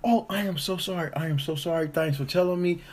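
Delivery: high, sweet apologetic voice; mock high apologetic lady. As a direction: gush apologies like a flustered stranger. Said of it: "She's like".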